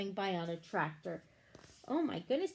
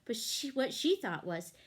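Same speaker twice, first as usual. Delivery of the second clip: usual speaking voice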